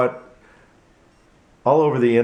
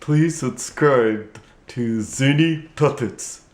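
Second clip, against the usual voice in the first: puppet popping up to greet; 3 semitones higher, much slower than usual. Acted. Puppet asks to subscribe.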